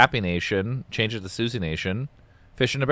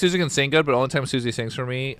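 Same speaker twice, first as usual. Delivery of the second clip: ordinary talk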